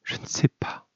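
hushed, whispered admission; soft, faint murmur. A narrator's reading. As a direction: whisper it hesitantly, barely voiced. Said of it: "puis murmura".

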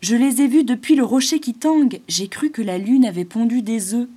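startled exclamation. Acted. Chamois Roche-Colombe stops at the pergola, loud and amazed. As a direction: blurt out the wonder. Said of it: "s'écria Roche-Colombe".

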